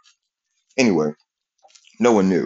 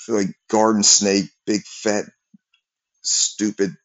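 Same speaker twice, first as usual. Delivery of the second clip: ordinary delivery, nothing marked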